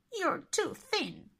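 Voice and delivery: high witch voice. A narrator's reading. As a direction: sneer like a witch. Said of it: "every day she said".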